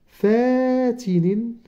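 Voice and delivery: slow dictation of فاتن for beginners; drawn-out dictation. Shown as a demonstration. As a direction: pull فاتن out long so pupils can follow. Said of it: "تاء مربوطة هكذا في الأخير".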